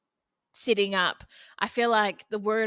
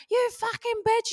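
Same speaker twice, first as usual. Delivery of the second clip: much higher mock yell; imitating a yell; 12 semitones higher than usual. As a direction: spit it out like an angry sister. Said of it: "yelling, going off at her".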